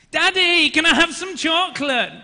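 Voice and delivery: put-on high, loud toddler whine; demanding small child. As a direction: imitate a little daughter loudly demanding a treat. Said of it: "she's used different tactics".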